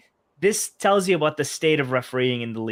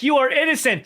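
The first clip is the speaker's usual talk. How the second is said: higher-pitched mock verdict; lightly acted judge, pitched up high; 9 semitones higher, 4 dB louder than usual. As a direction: declare it like a pretend judge. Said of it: "the judge is like".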